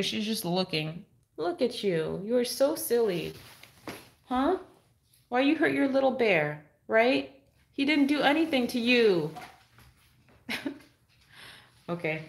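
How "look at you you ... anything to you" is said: lightly raised teasing scold; pet talk. Acted. Owner to her dog about hurting the bear, mild high pet talk. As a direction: gently tell off a silly dog.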